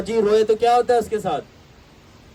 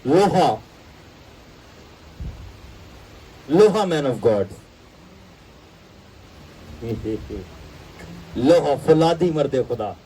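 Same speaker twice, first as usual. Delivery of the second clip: proud declaration; 4 semitones lower, slower than usual. Real, not acted. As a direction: ring out the word लोहा, firm and proud. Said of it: "अब मैंने बंदा बनना है".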